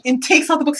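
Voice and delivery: normal talking voice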